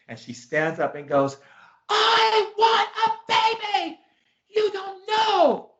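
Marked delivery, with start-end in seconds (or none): 1.9-5.7 very high, drawn-out shriek; acted scream. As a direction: scream it slowly like an outraged client. Client stands up, screams at him.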